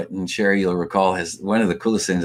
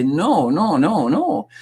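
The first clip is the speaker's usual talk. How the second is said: high, sing-out run of noes; emphatic refusal; 8 semitones higher, much slower than usual. Acted. Speaker urges parents and teachers to shake it up, emphatic refusal. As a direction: push back with repeated high noes. Urging people to refuse old habits.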